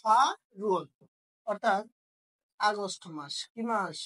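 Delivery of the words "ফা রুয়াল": ফা রুয়াল, second part starting on R; linked pronunciation. Shown as a demonstration. Teacher repeats the answer for students, ফা রুয়াল linked. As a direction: open ফা, then R-initial রুয়াল. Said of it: "কি উচ্চারণ হবে?".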